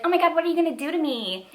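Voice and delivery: high, quick imitation of the heroine; mock eager heroine. Heroine asking her killer boyfriend what comes next, mock eager.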